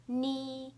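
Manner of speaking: "Ni" held high and level; first tone. Shown as a demonstration. Teacher showing the four tones, "Ni" high and level. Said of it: "I'll say the four tones".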